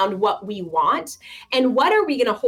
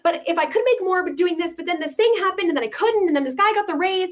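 higher and fast, over-explaining; a worried borrower over-explaining income, pitched up and quick; nervous client justifying; 7 semitones higher, faster than usual. Retold or imitated. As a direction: pile excuses on top of each other in a rush.

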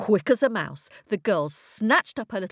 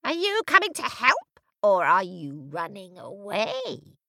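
witch screech. A narrator's reading. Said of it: "she screeched".

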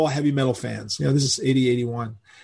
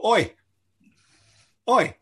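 light Cockney call of oi, oi, not raised; 8 semitones higher than usual. Retold or imitated. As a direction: call someone over in Cockney, oi, oi, casually. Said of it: "In England, they go".

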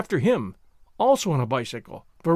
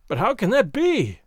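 higher-pitched, astonished outcry; amazed cry; 5 semitones higher, 4 dB louder than usual. A narrator's reading. Shocked man questioning his calm companion, amazed cry. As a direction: cry out in disbelief. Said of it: "he cried, amazed at my calm".

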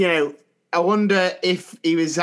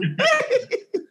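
high squeal of laughter. Real, not acted. Laughing at his own jingle, high squeal.

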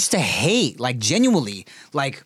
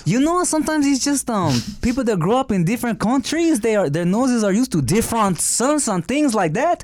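high, put-on French accent; 6 semitones higher than usual. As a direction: explain it as a mock Frenchman, pitched up. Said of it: "we were doing the French accent".

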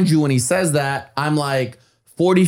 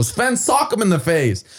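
loud order; 7 semitones higher than usual. Retold or imitated. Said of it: "I'm telling him loud".

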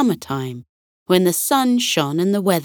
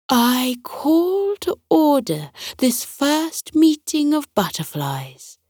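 stately butterfly queen; 8 semitones higher than usual. Acted. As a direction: open the meeting like a queen. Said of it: "the emerald birdwing announced".

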